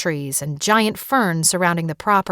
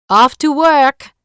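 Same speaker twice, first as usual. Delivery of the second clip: gaily; 7 semitones higher, 8 dB louder, slower than usual. Acted. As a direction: sing it out cheerfully as she leaves. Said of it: "Rosalie said gaily".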